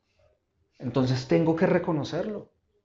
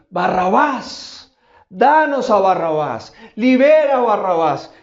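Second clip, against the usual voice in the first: mock crowd chant, drawn out and louder; crowd demanding; 4 semitones higher, 7 dB louder, slower than usual. The crowd answers Pilate, a mild mock chant. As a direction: demand Barrabas like the mob. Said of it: "Y todos empiezan a decir".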